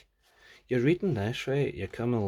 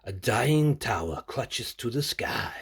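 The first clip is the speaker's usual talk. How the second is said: poetic reading; 6 dB louder, slower than usual. Shown as a demonstration. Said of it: "Should I say it in Scottish?".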